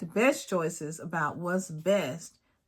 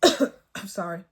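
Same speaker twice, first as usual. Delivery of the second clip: choked up; 5 dB louder, slower than usual. Real, not acted. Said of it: "I'm getting choked up".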